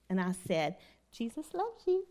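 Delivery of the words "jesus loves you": high, soft, sugary Jesus loves you; mock-sweet answer to the rude patient; sweet reply. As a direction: kill him with kindness, lightly. Sweetly answering the angry boss.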